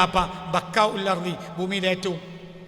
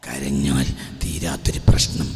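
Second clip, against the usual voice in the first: hushed sermon; slower than usual. Acted. Preacher's hushed intimate delivery.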